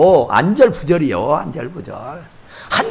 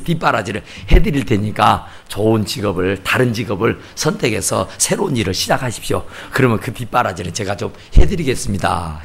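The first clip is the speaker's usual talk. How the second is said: solemn advice; 9 semitones lower than usual. Retold or imitated. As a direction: imitate an earnest man counselling the pastor. 남편이 정중히 전업을 권유. (낮고 점잖게 권유하는 말투)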